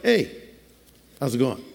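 low, soft, offhand hey; casual greeting. Shown as a demonstration. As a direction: toss it off like passing a neighbor. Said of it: "It was more like".

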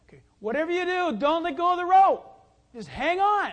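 yelled instructions. Retold or imitated. Pastor yelling to the boy on skis, loud shout. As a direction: call it out over the engine noise. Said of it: "I yelled out to him".

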